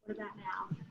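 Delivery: faint voice far from the mic; too quiet, mic too far away; quiet voice loud hiss. Real, not acted. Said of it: "No, still a little bit quiet".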